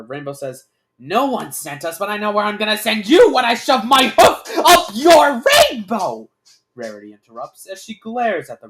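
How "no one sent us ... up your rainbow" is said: loud, rising furious threat; angry. A narrator's reading. Rainbow threatens the gunman, building to an angry shout. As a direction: build to a high, angry yell. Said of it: "stare with anger".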